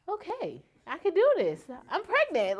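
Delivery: high-pitched, brightening realization; getting excited. Retold or imitated. Pregnant daughter after mom's support, getting excited. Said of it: "that's when I started getting excited".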